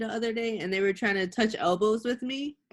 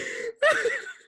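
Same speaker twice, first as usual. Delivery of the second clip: high laugh. Real, not acted. Laughing again.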